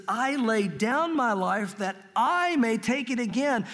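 slow reading, leaning hard on each "I"; stress on I. Shown as a demonstration. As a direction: draw out and stress the word "I". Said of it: "Notice the emphasis".

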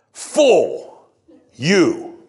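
exclaimed rebuke, heavy stress on FULL; emphatic exclamation. Shown as a demonstration. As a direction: fire out FULL, then YOU as separate blows. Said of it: "It's emphatic".

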